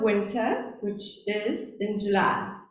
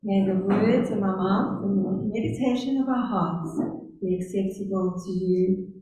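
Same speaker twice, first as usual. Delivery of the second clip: prayer; 2 semitones lower than usual. Acted. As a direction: lead the congregation gently in prayer. Opening prayer before sermon.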